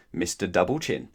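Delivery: ordinary delivery, nothing marked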